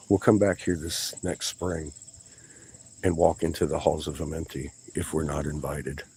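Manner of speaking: normal talking voice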